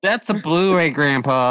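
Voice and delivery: a touch of mock scorn; mocking young voice. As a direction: needle the old guy about his disc. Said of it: "It's like".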